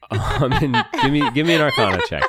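laughing. Real, not acted. GM laughs while saying it.

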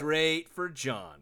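"Straight for John" drawn out slow, each word leaned on; slow emphatic punchline. Acted. Host telling cohost who the woman chooses, slow emphatic punchline. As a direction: let the joke land word by word.

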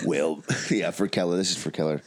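ordinary delivery

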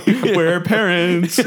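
laughing through the words; a laugh breaking the joke. Real, not acted. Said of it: "laughing aside".

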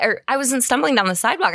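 ordinary talk

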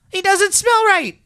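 very high, squeaky rabbit alarm; startled high-pitched accusation; exclaiming. A narrator's reading. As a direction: blurt it out as a high-voiced wild rabbit. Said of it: "he exclaimed".

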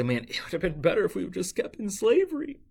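high mock whine, far above the plain voice; whiny complaining Israelites. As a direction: whine it like a spoiled crowd begging to go back. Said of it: "They say".

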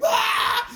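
a high, laughing fake scream of pain; hammed-up yelp of a man losing a finger; mock pained scream. Retelling a movie torture scene with a high mock scream.

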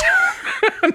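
high burst of laughter. Real, not acted. Laughing at her own Oakland taco joke, a high burst. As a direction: crack up suddenly.

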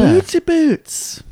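very high, drawn-out cutesy voice; playful squeaky cheer, stretched out; gleeful squeal. Pretend. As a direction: sing it out slowly in falsetto. Gleeful cheer over boots.

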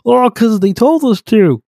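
high, slow, dopey answer; mock dim follower. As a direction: play a simple-minded villager stating the obvious. Mock dumb answer from believers.

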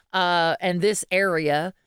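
normal talking voice

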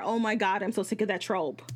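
ordinary delivery

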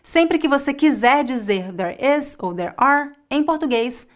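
ordinary talk, nothing marked

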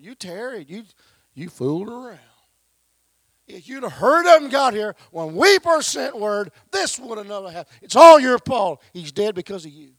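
high, loud blaming shout; angry accusation. Acted. Grieving Mary yells at Jesus, loud and high. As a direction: scream the blame at him. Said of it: "Mary's accusation builds to shouting".

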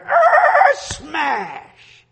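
high, loud imitated roar then smash; mock roar and smash. Imitating a noise heard outside, loud mock roar. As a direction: belt out a crash noise. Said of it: "Then I heard".

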